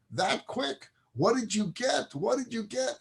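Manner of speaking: high-pitched, eager questioning; father impatient to hear the news; eager excitement. Acted. Father eagerly greets returning son.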